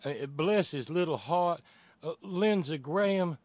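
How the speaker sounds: high, loud Southern lilt; put-on Southern sympathy; mock Southern accent. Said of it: "doing this in a Southern accent".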